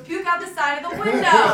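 loud, high imitation of a fed-up driver; insistent retold yell. Driver ordering sick passenger to use the window, insistent yell. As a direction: yell it as the driver, half laughing.

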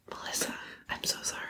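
soft whispered apology. Real, not acted. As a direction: whisper it so only she hears.

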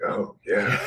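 sexy voice. Pretend. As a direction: tease it out seductively. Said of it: "I put on the sexy voice".

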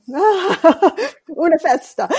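slow, laughing exclamation through a laugh. Real, not acted. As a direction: laugh it out, savoring the memory. Laughing recalling the big welcome.

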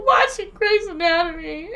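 high, tearful whine; telling her sister what she was watching, mock crying. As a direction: sob it out, hammed-up. Retelling crying on the phone.

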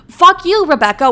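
high, loud fuck you shout; angry outburst. Acted. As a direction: blast it at the slogan-poster. Rant against Instagram slogan.

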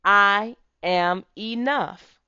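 loud, slow, firm affirmation; with conviction. Shown as a demonstration. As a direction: declare it with full conviction. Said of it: "say it with conviction".

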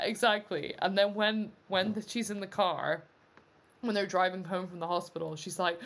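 ordinary talk, nothing marked

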